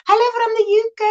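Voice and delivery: high, sing-song teasing hello; playful taunting greeting. Pretend. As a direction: tease a friend across the ocean. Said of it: "I won't tease you and say".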